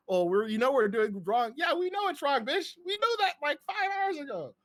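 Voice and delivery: mock high-pitched Skyler. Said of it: "she's like".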